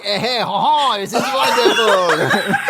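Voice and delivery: slow, high, purring delight, laughing; pleased cat. Acted. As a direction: suara kucing senang. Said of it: "Oscar's like".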